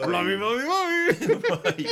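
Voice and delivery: high, loud, drawn-out cartoon babble; Mister Blobby. Teasing a co-host about a TV character, silly high creature voice. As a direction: burble it slowly in a silly high voice. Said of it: "why don't you like Mister Blobby?".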